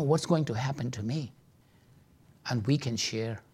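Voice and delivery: ordinary talk, nothing marked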